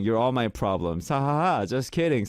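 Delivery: usual speaking voice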